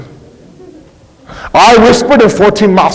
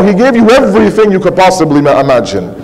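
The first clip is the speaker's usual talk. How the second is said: ordinary talk, nothing marked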